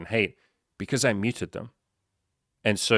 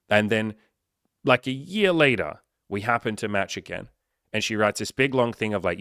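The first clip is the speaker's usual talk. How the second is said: normal talking voice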